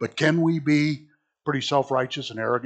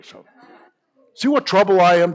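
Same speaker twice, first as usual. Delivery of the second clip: ordinary talk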